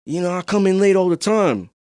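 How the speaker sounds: hammed-up careless employee; mock slacker. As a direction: brush off the boss like he's your buddy. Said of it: "mocking employee who thinks boss is homie".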